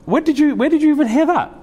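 a high, incredulous laughing cut-in; retold startled interruption. Imam interrupting a mother at the madrasa, incredulous. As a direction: stop her mid-sentence in disbelief. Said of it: "I said".

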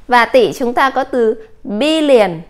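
ordinary talk

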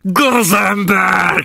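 high, slow furious shout. A narrator's reading. The captain screaming at the prisoner, furious shout. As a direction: bellow the insult at him. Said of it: "kiáltotta a kapitány".

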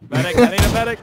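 high, loud mock call for a medic; man down shout. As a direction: yell it like you're bleeding out. Calling for a medic mid-fight.

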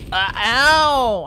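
high, loud drawn-out ow; exaggerated pained cry; mock pain. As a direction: yelp as if you tripped. Hurt after falling.

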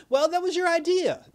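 high, loud "told you so"; exasperated. Real, not acted. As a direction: snap back in disbelief. Exasperated retort at the sons.

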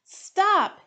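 high, loud yell. Acted. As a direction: shout to halt everyone. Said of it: "Hippo yelled".

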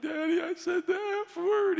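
high-pitched mock wail of a little boy; squeaky, put-on crying voice; imitated child crying. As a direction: wail a confession like a tearful child. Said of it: "He starts crying".

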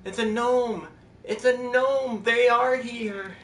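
high, loud, drawn-out exclamation; excited outcry. Real, not acted. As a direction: call it out thrilled, stretching the words up high. Sees gnomes again, calls out.

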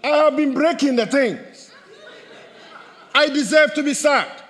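loud, high outcry of disbelief; emphatic exclaim. Acted. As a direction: nearly shout it in astonishment. Preacher exclaims his own surprise.

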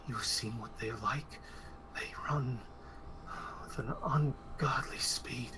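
slow, hushed warning of the horde; slow fearful whisper. Acted. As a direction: whisper the danger slowly. NPC warns in whisper.

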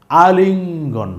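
আলিঙ্গন drawn out slowly, each sound held for learners; slow and clear teacher's model of আলিঙ্গন; slow model reading. Shown as a demonstration. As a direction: stretch আলিঙ্গন out slowly so the class can copy it. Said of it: "একটু বুঝে রাখেন".

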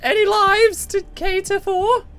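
theatrical call. Pretend. Calling out to the hotel demon.

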